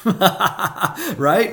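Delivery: laughing high and loud; amused laugh at own cravings. Real, not acted. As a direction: laugh through the question. Laughs about wanting dessert.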